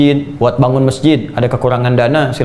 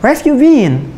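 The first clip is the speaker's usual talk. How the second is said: lightly put-on English accent, a bit higher; 10 semitones higher than usual. Shown as a demonstration. Preacher shows Indonesians can sound like the English, light English accent. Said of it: "kayak orang Inggris".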